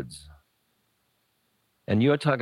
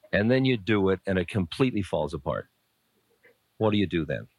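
ordinary delivery

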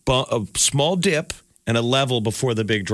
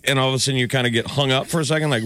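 ordinary delivery

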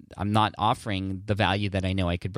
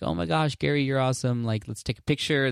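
higher-pitched imitation of an excited fan; 6 semitones higher than usual. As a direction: gush lightly like a fan meeting an idol. Retold fan greeting at car window.

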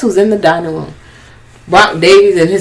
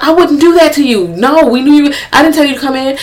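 higher-pitched acted denial; mock protesting; 8 semitones higher than usual. Voicing friends denying they told her, high mock protest. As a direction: deny it in a raised, mimicking voice.

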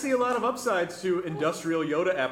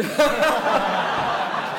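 hearty laugh; 3 semitones higher, 5 dB louder than usual. Real, not acted. Laughing at Twilight joke.

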